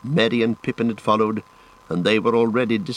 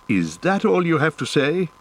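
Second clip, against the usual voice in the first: raised pitch, sternly questioning; hard, unkind challenge; 7 semitones higher than usual. A narrator's reading. Ranger challenging a returning companion, sternly. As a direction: press him with a pointed question. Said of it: "looking hard and not too kindly".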